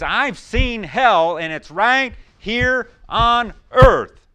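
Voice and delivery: loud, high, very slow proclamation; soldier's grim claim. Retold or imitated. Preacher voicing the soldier, loud and slow. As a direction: drag each word out like a haunted veteran.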